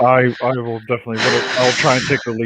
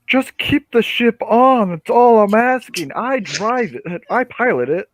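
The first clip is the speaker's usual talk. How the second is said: much higher and slow, spelling it out; exasperated; 9 semitones higher, slower than usual. Acted. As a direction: talk slowly like it's obvious. Exasperated pilot explaining slowly.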